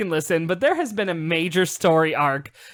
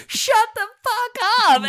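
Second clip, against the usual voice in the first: high, loud retold scream at the screen; outraged mock screech; retold yell; 5 dB louder than usual. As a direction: yell it like someone fed up with a narrator. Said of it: "He yells at the screen".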